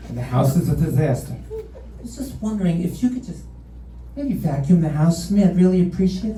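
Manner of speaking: mock his wife's polite request, lightly put on; imitating wife. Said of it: "my wife sounds nothing like this".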